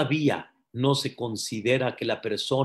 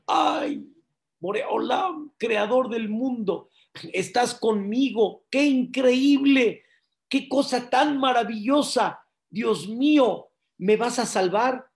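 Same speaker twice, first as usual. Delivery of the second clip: overjoyed amazement; 9 semitones higher, 3 dB louder than usual. Acted. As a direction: marvel that God has come to save you. Climber amazed God answers him. (asombro exaltado)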